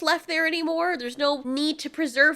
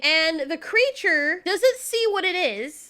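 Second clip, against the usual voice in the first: ordinary talk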